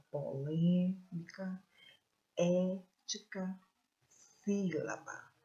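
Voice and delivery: "polêmica, ética, sílaba" stretched on "lê", "é", "sí"; stressed antepenultimate syllables. Shown as a demonstration. Teacher showing the strong syllable, "polêmica, ética, sílaba" stressed on "lê", "é", "sí". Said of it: "Olha a posição da sílaba tônica".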